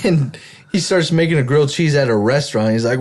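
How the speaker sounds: usual speaking voice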